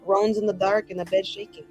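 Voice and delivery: normal talking voice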